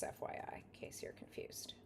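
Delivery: soft unvoiced aside whisper. Real, not acted. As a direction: whisper it to the viewer. Aside while sleeving cards.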